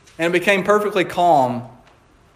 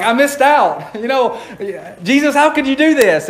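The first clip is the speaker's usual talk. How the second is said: whiny Thomas; 7 semitones higher than usual. Retold or imitated. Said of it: "he's like".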